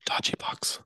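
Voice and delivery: hushed whisper. Real, not acted. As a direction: whisper it like a secret. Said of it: "don't say it out loud".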